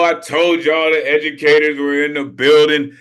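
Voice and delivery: ordinary talk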